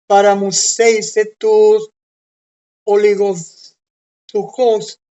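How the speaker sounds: slow, loud Greek with a joking Maracucho accent; Greek read in Maracucho accent. Shown as a demonstration. Teacher reading the Greek verse to his class, slow, loud, in Maracucho accent. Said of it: "buena pronunciación en maracucho del texto".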